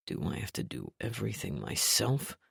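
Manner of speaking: low, soft grumbling mutter. A narrator's reading. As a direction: mutter it under your breath. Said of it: "Trent muttered".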